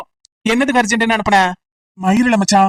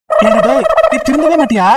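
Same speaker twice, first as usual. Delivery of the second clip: raised, higher scolding shout at a friend; exasperated yell; 4 semitones higher than usual. Acted. Friend shouting at his pal over the phone, exasperated yell. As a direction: let the frustration burst out.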